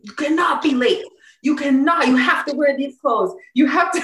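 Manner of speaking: high, loud, panicky orders to the group; frantic bossy yell. Retold or imitated. To her running group before Oprah comes, frantic loud orders. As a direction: bark orders like a drill sergeant on edge. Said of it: "I was like, psycho the whole day".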